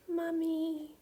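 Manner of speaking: put-on a calmed baby murmuring for mummy; sobbing toddler. A narrator's reading. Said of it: "relaxing and sobbing".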